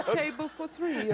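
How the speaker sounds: put-on Italian accent. Tourist asking for a restaurant table, slow mock-Italian accent. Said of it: "if you have the Italian accent".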